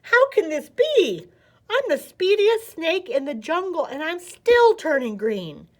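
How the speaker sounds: put-on shocked young snake wailing in disbelief; dismayed shock. A narrator's reading. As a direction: cry out in panic at the news. Snake shocked at turning green.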